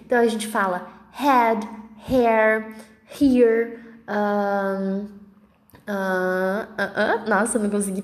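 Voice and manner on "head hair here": "head, hair, here", each starting with breathed H; English H sound. Shown as a demonstration. Teacher lists H words for students, English H sound. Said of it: "o H tem som de R".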